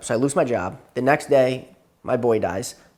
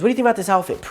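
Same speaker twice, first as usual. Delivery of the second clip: higher-pitched mock girlfriend chatter; lightly mocking imitation, higher voice; imitating yapping girlfriend; 8 semitones higher, much faster than usual. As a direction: mimic her chatty question, pitched up. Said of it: "She's yapping to me. She goes".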